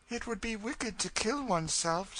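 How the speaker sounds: put-on high, earnest girl's voice; innocent young girl. Girl recalling her mother's warning, earnest protest. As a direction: protest earnestly, pitched well up.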